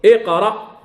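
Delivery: "اقرأ" as iqra, with an i before the q; helping initial vowel. Shown as a demonstration. Teacher showing how hamza enables speech, "اقرأ" begun with i. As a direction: open "اقرأ" on a clear kasra hamza. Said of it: "لتتمكن من النطق بالسكون".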